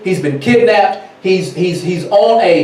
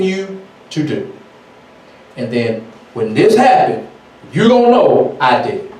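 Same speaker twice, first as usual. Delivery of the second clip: voice of Yah; 2 semitones lower than usual. Acted. Yah warning his people, lightly voiced divine role. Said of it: "And Yah said".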